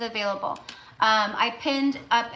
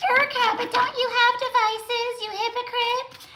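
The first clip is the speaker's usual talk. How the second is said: much higher, put-on voice of a smug critic; mock critic voice; 14 semitones higher than usual. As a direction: needle her like a snarky commenter calling her out. Voicing an imagined critic commenter.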